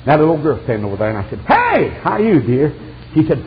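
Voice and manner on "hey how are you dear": loud, high "hey"; high, punchy greeting; calling out greeting. Retold or imitated. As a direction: holler a hello across to someone. Preacher greets little girl.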